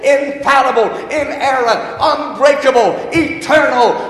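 loud, ringing preacher's cry, building; preaching at full force. Acted. Pastor rousing the congregation, loud preaching. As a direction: proclaim it to the whole church. Opening prayer and Scripture exaltation.

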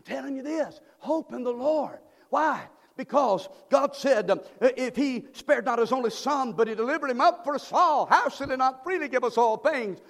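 loud, high climactic preaching; full-force preaching. Real, not acted. Pastor preaching the Lord's return, loud and high. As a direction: drive it home to the climax.

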